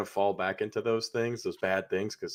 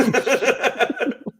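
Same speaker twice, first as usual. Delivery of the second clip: high laugh; 13 semitones higher than usual. Real, not acted.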